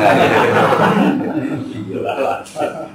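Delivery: long airy laughter; breathy laugh. Real, not acted. Laughs at joke. (tawa panjang berdesah)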